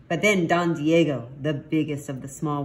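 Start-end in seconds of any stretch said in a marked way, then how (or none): none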